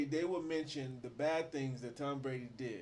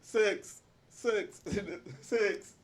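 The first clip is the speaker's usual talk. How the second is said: laughing echo; 8 semitones higher, 8 dB louder, much slower than usual. Acted. Cohost echoes laughing.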